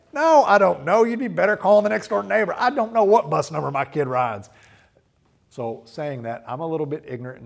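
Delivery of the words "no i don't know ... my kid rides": much louder, higher exasperated protest; clueless dad. Retold or imitated. A comedian's dad answering the school principal, loud exasperation. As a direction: blurt it out like a dad who has no idea. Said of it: "comedian's bit, like".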